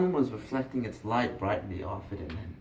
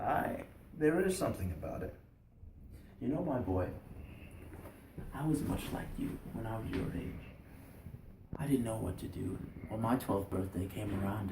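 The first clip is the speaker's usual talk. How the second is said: lower, softer mentor voice; reminiscing fisherman; 5 semitones lower, 6 dB quieter than usual. Acted. Fisherman telling boy of his own youth, reflective. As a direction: look back quietly on boyhood.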